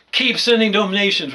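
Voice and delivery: mocking plea. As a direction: parody the doctors begging for cash. Mocking doctors asking for money.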